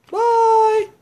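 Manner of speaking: very high playful squeak; squeaky high bye. Pretend. Vlogger signing off to viewers, squeaky high bye.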